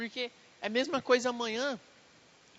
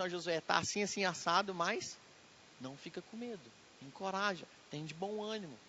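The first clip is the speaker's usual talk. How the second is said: softer and softer, calming a frightened man; a soft reassuring voice, fading out; tender comfort; 3 semitones lower, 6 dB quieter than usual. Acted. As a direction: ease his fear gently, almost hushed. God comforting Joshua.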